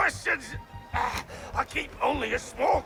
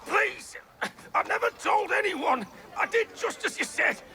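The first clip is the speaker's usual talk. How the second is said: higher, desperate begging; panicked, pitched-up protest; frightened pleading; 6 semitones higher than usual. Acted. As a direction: plead for your life. Cornered man begs for mercy.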